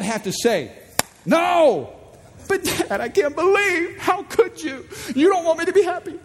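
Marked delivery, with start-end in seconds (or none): 1.2-1.9 sharp high shouted no; a dad yelling no at the outlet; stern shout. Acted. Father yelling no to his son at the outlet, stern shout. 2.5-6.2 put-on high whining child voice, almost crying; a kid protesting, near tears; whiny kid. Son protesting to his father, whiny child voice.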